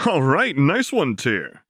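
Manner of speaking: high, loud cheer. A narrator's reading. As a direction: celebrate his friend's win. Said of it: "Quinn cheered".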